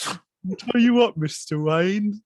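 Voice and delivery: slow butler voice, laughing through it; Michael Caine impression. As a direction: play the loyal butler warning his master, slow. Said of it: "sounds like a deeper voiced Michael Caine".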